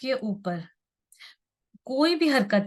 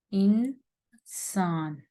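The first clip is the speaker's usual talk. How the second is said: "इंसान" drawn out in two slow, separated syllables; much slower than usual. Shown as a demonstration. Teacher showing learners how to read the word, syllable by syllable. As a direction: split "इंसान" slowly as in-, then a long saan. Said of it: "आप इसको इस तरह समझिए".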